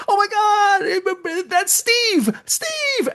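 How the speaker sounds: very high, shrieking alarm; hammed-up horror at the abduction; mock panic. Actors panic at beamed friend.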